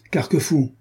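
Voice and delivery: high call across the room. A narrator's reading. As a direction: shout the name to summon him. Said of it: "cria Renaud".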